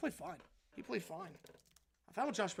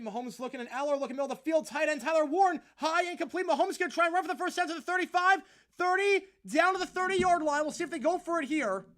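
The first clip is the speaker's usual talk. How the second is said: high, loud, fast calling of the action; rising excitement as the play unfolds; excited play-by-play; 7 semitones higher, 10 dB louder than usual. Acted. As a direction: call the drive like a live broadcaster. Live play-by-play call.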